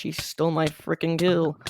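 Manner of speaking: ordinary talk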